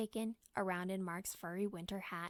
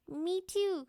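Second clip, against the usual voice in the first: high little squeal; 8 semitones higher, 5 dB louder than usual. Acted. Youngest child wanting in, high squeal. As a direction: squeak it like a small boy. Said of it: "squealed little Mike".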